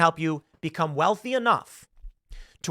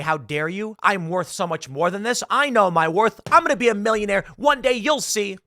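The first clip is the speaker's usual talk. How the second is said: mock indignation building louder and higher; offended; 4 semitones higher, 3 dB louder than usual. Offended candidate rejects fifteen an hour, mock indignant outburst. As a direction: protest with hammed-up wounded pride. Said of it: "Do you get offended and say".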